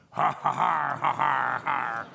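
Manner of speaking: loud mock guffaw of rough sailors; loud mocking laughter. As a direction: bellow out a taunting ha ha ha.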